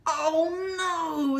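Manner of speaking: high, loud wail of dismay; Mister Bear despairing. Acted. Mister Bear kept awake by snoring, high loud despair. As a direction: moan it out, fed up. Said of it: "said Mister Bear".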